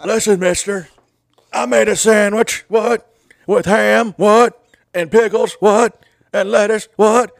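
mock sandwich ad, hype man shouting What after every line; hype-man impression. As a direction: bark each item like a hype man. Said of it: "just gonna be impressions".

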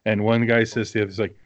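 normal talking voice

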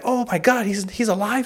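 high, astonished gasp of disbelief; shocked friends. Retold or imitated. Friends stunned to hear Goku survived, high shocked voice.

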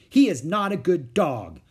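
put-on loud, slow stubborn child's protest; contradicting. A narrator's reading. Little girl contradicting Henry about his dog, stubborn and loud. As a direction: insist he's bad, loudly. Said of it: "contradicted Ramona".

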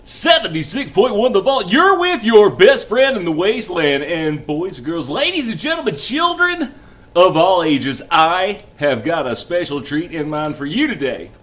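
booming showman announcer intro; ringmaster. Acted. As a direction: roll up, roll up, big showman hype. Radio host opening a show.

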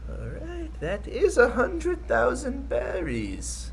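put-on high, slow frail old man; creaky old man counting. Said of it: "It's this super frail old man".

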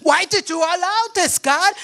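high whiny plea to God; complaining believer. Acted. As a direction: play a childish complainer. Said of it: "that's the same way".

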